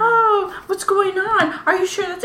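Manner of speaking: high, mock-concerned questions; fussing over someone. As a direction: imitate people crowding in with worry. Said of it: "all on top of me".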